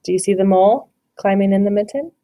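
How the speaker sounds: ordinary delivery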